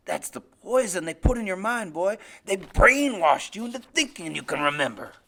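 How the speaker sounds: loud, forceful, menacing tirade; angry father. Acted. Father says they brainwashed his son, loud angry menace. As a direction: hammer the boy with it.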